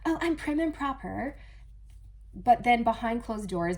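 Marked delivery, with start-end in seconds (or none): 0.1-1.4 prissy higher put-on voice; prim and proper. Voicing the uptight person, prissy and higher. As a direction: act dainty and proper. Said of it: "that type of person".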